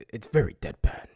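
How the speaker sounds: lightly attempted deadpan movie-star voice; a hint of a husky, straight-faced tough guy; Liam Neeson voice. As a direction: try a dry, deadpan actor impression, with pauses. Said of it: "my best Liam Neeson voice".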